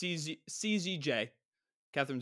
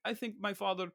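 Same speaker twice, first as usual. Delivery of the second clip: halting broken English in a mock Slavic accent; Tom Hanks. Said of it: "this weird broken English, Bulgarian, Russian accent".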